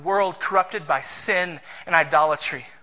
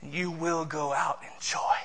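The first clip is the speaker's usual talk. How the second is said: soft promise; 3 semitones lower, 8 dB quieter than usual. Acted. Quiet reading of the promise.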